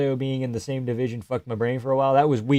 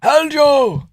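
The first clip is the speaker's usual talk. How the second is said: put-on high accented shout; high Georgian yell; Merab impression; 6 dB louder than usual. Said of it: "I can see him yelling".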